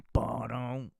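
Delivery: ordinary talk, nothing marked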